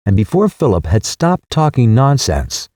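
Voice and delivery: plain reading voice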